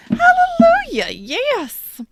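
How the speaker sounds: high, loud cry of hallelujah; joyful shout. Real, not acted. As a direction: belt it out like a gospel cry. Celebrating the reading.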